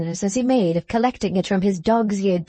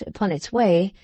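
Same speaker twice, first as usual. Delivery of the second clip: plain reading voice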